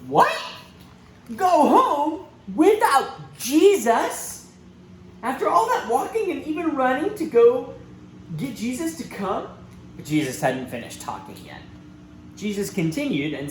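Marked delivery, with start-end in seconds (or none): 0.1-9.5 can't believe what he just heard; shocked disbelief. Acted. Father stunned that Jesus won't come, incredulous.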